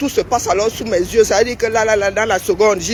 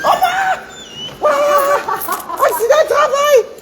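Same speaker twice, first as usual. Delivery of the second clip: high, loud yelp of pain; pained outcry; 5 dB louder than usual. Acted. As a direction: cry out high like a stubbed toe. Toe hurt, crying out.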